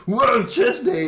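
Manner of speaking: high, loud goofy whoa; hammed-up goofy exclamation; goofy meme voice. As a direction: ham it up like a meme kid.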